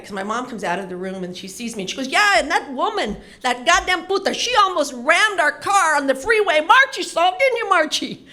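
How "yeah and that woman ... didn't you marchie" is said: high, loud, furious mom rant with a laugh. Retold or imitated. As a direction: rage at the other woman, all in one breath. Mom ranting about rival woman.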